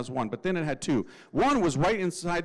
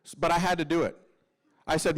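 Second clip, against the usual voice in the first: ordinary delivery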